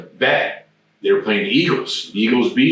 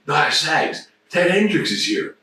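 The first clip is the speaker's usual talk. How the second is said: excited news; 6 semitones higher than usual. Retold or imitated. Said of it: "he goes".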